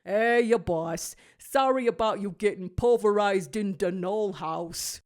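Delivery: a hint of a creaky old walrus; Willy the Walrus. A narrator's reading. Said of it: "hear Willy the Walrus".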